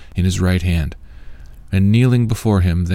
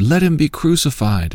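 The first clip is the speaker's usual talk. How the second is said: higher-pitched crowd demand, read aloud; crowd's demand; 8 semitones higher than usual. A narrator's reading. Crowd answering Pilate about Jesus, raised-pitch demand. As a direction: narrator lifting the pitch for the crowd's reply. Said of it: "They all said".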